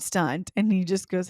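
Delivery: ordinary talk, nothing marked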